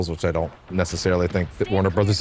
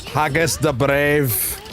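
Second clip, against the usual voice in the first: slow, higher mock Scottish accent; 8 semitones higher, much slower than usual. Shown as a demonstration. Gamer reads a character name in a Scottish accent. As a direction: roll out Haggis the Brave in a Scottish accent. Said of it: "in a Scottish accent".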